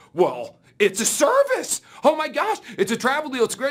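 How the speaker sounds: mock thrilled recruit; rising excitement. Recruit tells coworker about the travel deal, excited voice.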